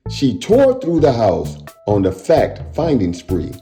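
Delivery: plain reading voice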